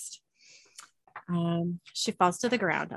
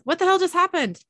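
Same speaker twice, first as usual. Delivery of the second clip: surprised; 10 semitones higher, 5 dB louder than usual. Acted. Sam wakes up confused.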